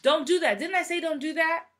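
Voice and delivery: mock-annoyed don't do that; scolding a child. Parent scolding a kid, exasperated. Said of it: "Those of you with kids".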